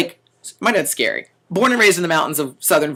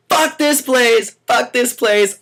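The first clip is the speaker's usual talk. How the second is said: slow, higher, angry shout; retold parting shout; 7 semitones higher, 3 dB louder, much slower than usual. Waiter storming out of the restaurant, slow and angry. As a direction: storm out yelling it. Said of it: "I went out saying".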